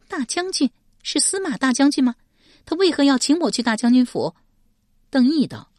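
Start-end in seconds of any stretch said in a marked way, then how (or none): 0.1-4.3 high, louder astonished woman. A narrator's reading. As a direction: question it in high-pitched disbelief. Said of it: "史佩大为惊异道". (大为惊异，高声追问)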